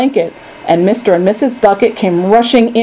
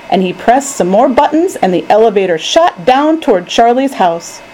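plain reading voice

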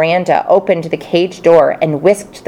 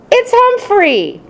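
high, slow delighted shout; excited yell; slower than usual. Acted. Miranda telling the class, high delighted shout. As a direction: point him out with glee. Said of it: "she yelled".

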